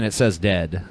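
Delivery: normal talking voice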